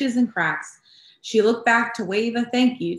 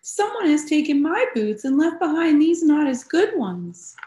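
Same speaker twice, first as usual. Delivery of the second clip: put-on little Baby Bear; 9 semitones higher, 4 dB louder than usual. Baby Bear voiced in story.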